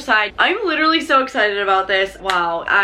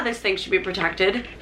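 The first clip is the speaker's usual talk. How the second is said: ordinary talk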